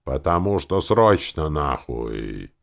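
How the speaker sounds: loud, high outburst dropping to a slow finish; exasperated, heavily stressed swearing; outraged exclamation. A narrator's reading. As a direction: explode in indignation. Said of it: "воскликнул Таварин".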